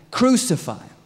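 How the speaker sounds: high, loud cry of demand; sharp rise into a mob yell; acted crowd shout. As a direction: shout it over the governor. Crowd shouts over Pilate.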